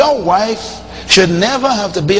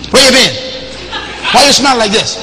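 nagging wife; 5 semitones higher than usual. Retold or imitated. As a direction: fire questions at him like a nagging wife. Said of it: "She goes".

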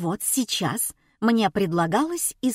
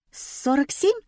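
high, rising guess; tentative guess; 7 semitones higher than usual. A narrator's reading. Heroine guessing the sum before the crowd, tentative. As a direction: offer it as a question. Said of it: "предположила я".

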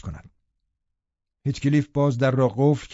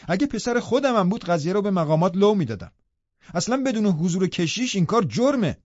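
higher, indignant nurse scolding back; upset protest; 6 semitones higher than usual. Acted. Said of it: "من با ناراحتی گفتم". (اعتراض خشمگین و بلندتر)

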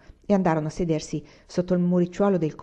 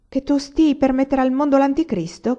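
high, loud mocking question; ironic; 7 semitones higher, 6 dB louder than usual. A narrator's reading. Farmer mocking Rebecca's omen before the neighbours, ironic. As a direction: tease with exaggerated mock alarm. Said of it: "chiese la voce ironica di un contadino".

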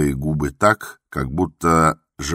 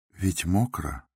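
inner thought; 3 semitones higher, 5 dB quieter, slower than usual. A narrator's reading. The doctor thinks to himself, softly. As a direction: think it quietly to yourself. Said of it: "подумал он".

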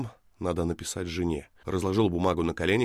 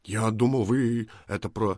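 hesitant, slightly raised excuse trailing off; flustered; 4 semitones higher, 4 dB louder than usual. A narrator's reading. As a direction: stammer out an excuse in confusion. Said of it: "Этил смутился и растерялся".

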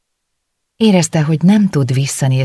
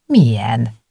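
snippy. A narrator's reading. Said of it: "kérdezte Éva csípősen".

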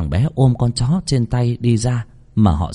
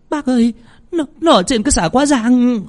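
much higher frightened calling out; panicked cry; 14 semitones higher than usual. Acted. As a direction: cry out to the old man in fear. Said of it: "Chị Tơ hoảng hốt la lên".

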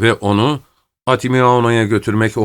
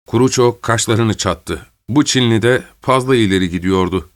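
plain reading voice

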